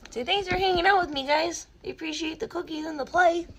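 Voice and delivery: high, fast, loud voicing of the cat; cat's voice. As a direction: speak for the cat like an eager little youtuber. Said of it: "Voya, we're not on YouTube".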